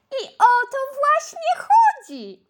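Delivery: high, bright-pitched chorus of ladles; squeaky storybook ladle voice; high character voice. Acted. Spoon ladles answer in chorus.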